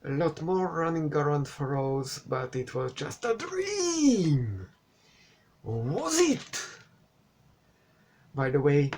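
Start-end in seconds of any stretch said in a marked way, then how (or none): 2.3-4.7 rising into a drawn-out, high "dream"; mock-dramatic reveal. Reviewer mocking the film's twist, melodramatic. 5.6-6.6 high, swooping teaser question; hammed-up twist question; mock-dramatic suspense. Reviewer teasing the twist, mock-dramatic.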